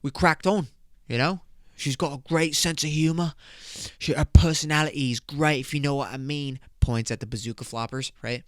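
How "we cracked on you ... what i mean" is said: put-on British lad boasting to mates; mock British accent. Lad tells his mates how it went, mock British accent. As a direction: swagger through it in a fake British accent. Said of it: "I go back to my mates".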